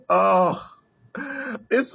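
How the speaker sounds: usual speaking voice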